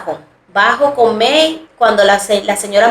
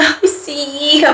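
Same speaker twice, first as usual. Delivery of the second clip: put-on kindly old neighbour; 5 semitones higher, slower than usual. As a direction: give a sweet elderly lady's gentle yes. [name] voices the elderly neighbour.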